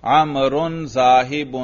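slow, deliberate Arabic reading; slow model reading. Shown as a demonstration. Teacher slowly modelling an Arabic phrase for the class. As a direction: read it slowly for the student to repeat. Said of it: "روانی سے پڑھیے بھائی".

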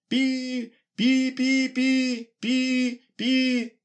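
high beeping Morse signal sounds; mock Morse beeps. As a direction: beep it like a radio signal. Imitating Morse code beeps. (имитация писка морзянки)

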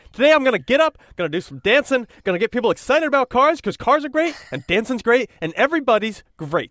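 high loud cheery pep; excited tube guy. Pretend. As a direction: bounce it like a giddy mascot.

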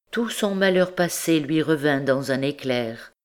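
usual speaking voice

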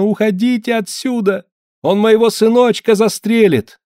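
high, loud wailing woman. A narrator's reading. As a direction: wail it out in panic. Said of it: "заголосила женщина".

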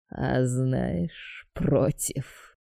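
quiet sinister admission; dark god voice. A narrator's reading.

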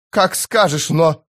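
high-pitched, uncertain giving in; anxious assent. A narrator's reading. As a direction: give in nervously and trail off. Panicked commander gives in, hesitant. (растерянное согласие)

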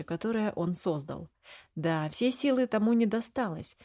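plain reading voice

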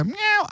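high imitated miaow; cat meow. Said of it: "So G-Cat will go".

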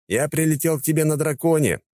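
rising exasperated rant; "на драконе" said in flowing outrage. Acted. First plain take.

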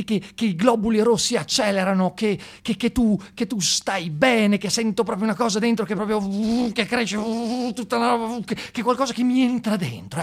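high, swooning mock ecstasy; mock rapture. Comic gushing about Gheddafi's arrival, high mock ecstasy. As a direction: gush like someone falling in love.

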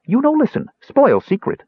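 high, squeaky dwarf scolding; Bupu. A narrator's reading. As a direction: warn like a bossy little creature. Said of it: "Bupu stated".

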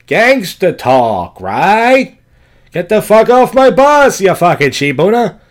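loud, drawn-out mock gangster; bad gangster impression. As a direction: yell it like a hammed-up mobster. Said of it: "That's not a good impression".

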